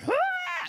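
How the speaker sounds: sudden high mock shriek of a greedy kid; imitated kid shriek. Kid at the checkout shrieking for candy, high mock yell. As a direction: yelp it like a child grabbing for candy.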